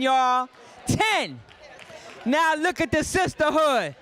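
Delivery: normal talking voice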